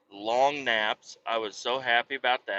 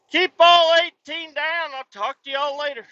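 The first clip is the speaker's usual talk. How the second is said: trucker sign-off; 5 dB louder than usual. Acted. Trucker signing off to his viewers, CB call style. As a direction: sign off like calling over the CB.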